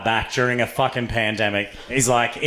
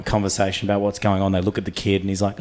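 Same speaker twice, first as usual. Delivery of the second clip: ordinary delivery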